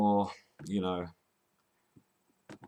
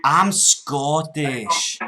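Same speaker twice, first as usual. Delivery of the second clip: very slow, drawn-out Scots; broad Scottish accent; 4 dB louder, much slower than usual. Pretend. Prank caller repeating to scammer, broad Scottish. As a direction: stretch it out as a put-on Scot.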